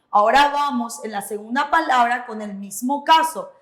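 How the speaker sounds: normal talking voice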